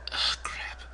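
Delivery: breathy whisper of dismay under the breath; a whispered groan over a mistake; whispered curse. Real, not acted. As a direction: mutter it to yourself in a whisper. Realizes he overspent in game.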